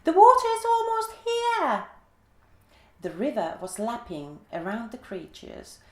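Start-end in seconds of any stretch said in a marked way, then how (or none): 0.0-1.9 much higher, loud squeak; the giant giraffe offering help in a tiny squeak; squeaky giraffe voice. A narrator's reading. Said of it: "he squeaked in his high voice".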